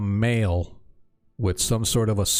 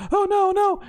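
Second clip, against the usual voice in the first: very high, rising panic, a little louder; panicked Alyssa; 4 dB louder than usual. Acted. Alyssa realizing she broke the circle, panicked high voice. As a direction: repeat it in growing horror.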